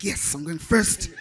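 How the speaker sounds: loud, slow, playful impression of excitement; excited retelling. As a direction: imitate an eager colleague, stretching the words with volume. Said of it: "was excited".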